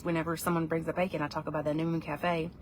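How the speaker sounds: normal talking voice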